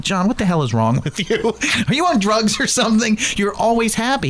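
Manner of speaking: high, incredulous teasing with a laugh; mock disbelief. Telling coworker John he must be on drugs, high mock disbelief. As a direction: act amazed at his good mood, laughing. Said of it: "I looked at him and said".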